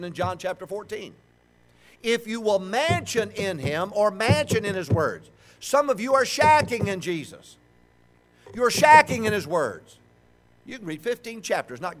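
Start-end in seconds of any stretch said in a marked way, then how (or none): 2.0-10.0 loud, slow, high preaching; forceful preaching. Acted. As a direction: drive the point home to the crowd.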